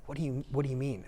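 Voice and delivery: imitating a very quiet, puzzled voice; barely audible, confused reply; soft-spoken quote. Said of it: "he always talks real soft".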